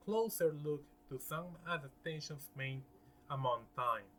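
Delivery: ordinary delivery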